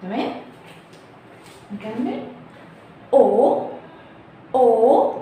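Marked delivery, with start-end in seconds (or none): 3.1-5.1 او with the mouth wide open. Shown as a demonstration. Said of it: "نفتح بقنا واحنا بنقولها". (او بفتح الفم)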